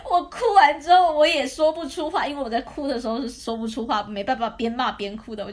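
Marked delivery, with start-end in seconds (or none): none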